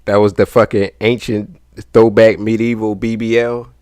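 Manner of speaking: real, loud outburst of amused disbelief. Real, not acted. Viewer reacting to a medieval butt-padding clip, loud and incredulous. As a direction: exclaim it loudly, incredulous. Reacting loudly to the clip's revelation.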